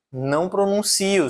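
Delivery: ordinary delivery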